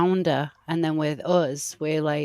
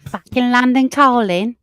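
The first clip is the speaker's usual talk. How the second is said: London calling; 6 semitones higher, 6 dB louder than usual. Acted.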